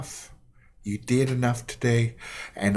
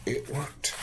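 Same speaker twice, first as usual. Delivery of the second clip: ordinary delivery, nothing marked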